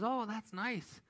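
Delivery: a mild, polite wife's reply. Retold or imitated. Wife answers farmer with a high, mild voice. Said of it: "She goes".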